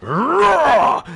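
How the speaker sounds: loud, high anime power-up roar; full-throated battle cry. Acted. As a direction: roar like a cartoon warrior. Piccolo battle cry demo.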